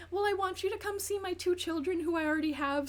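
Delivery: high, whiny voice; mock whiny girlfriend. Girlfriend complaining he won't visit her kids, high and whiny. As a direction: whine it like a spoiled girlfriend. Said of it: "she's like".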